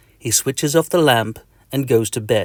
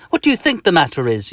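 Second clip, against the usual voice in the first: quick, higher irritated retort; snappy; 7 semitones higher, 4 dB louder, faster than usual. Acted. Itchy patient answers the doctor, snapping. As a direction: snap it back fast. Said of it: "you snap".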